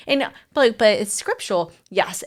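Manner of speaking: ordinary talk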